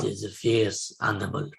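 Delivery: low, put-on monologue voice; Jon Voight. Film fan making up the actor's monologue, low mock-grave voice. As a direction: intone it low, as a hammy snake hunter. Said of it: "I don't think that's what he says".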